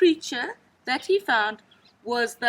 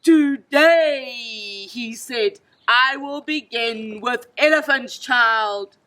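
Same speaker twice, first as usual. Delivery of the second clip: menacing crocodile; 8 dB louder, slower than usual. A narrator's reading. Crocodile threatens the child.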